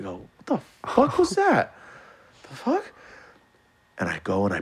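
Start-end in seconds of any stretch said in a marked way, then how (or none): 0.4-2.8 hammed-up alarm; startled. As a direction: jolt at the noise. Startled by a noise.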